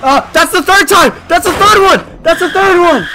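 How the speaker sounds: loud, high gleeful yelling; excited shout. Real, not acted. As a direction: cheer it out loud. Car explodes a third time.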